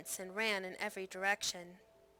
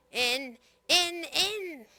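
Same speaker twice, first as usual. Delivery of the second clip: furious high shout, louder; raging; 7 semitones higher, 7 dB louder, much slower than usual. A narrator's reading. Blind Pugh drives the pirates inside, furious high shout. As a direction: scream the order in a blind rage. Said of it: "as if he were afire with rage".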